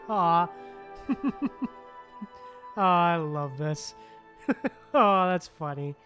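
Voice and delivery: ordinary talk, nothing marked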